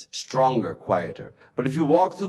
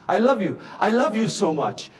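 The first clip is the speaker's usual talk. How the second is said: tearful; 9 semitones higher than usual. Acted. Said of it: "Her voice was lost in tears".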